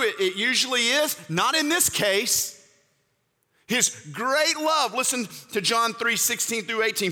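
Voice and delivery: high, urgent preaching push; fervent preaching. Acted. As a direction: drive it home to the congregation. Preaching at full force.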